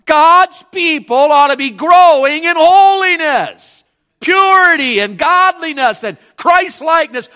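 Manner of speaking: high, loud, slow proclamation; slow preaching cry. Real, not acted. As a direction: lift the congregation up. Preaching peak.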